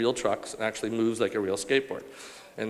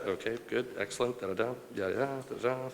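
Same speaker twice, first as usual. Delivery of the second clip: quieter skim through written notes; skimming aloud; 6 dB quieter than usual. Real, not acted. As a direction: murmur offhandedly through bits already covered. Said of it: "I already read that".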